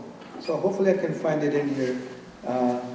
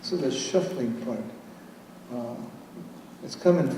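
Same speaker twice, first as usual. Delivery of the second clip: usual speaking voice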